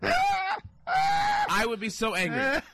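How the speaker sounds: slow, high, laughing yell; high mock fury through laughter; mock rage. Real, not acted. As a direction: stretch it out in a laughing howl. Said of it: "You're so upset".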